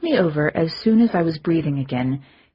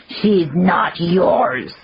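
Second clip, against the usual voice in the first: slow firm verdict; 4 dB louder, much slower than usual. Acted. Lushak's final verdict.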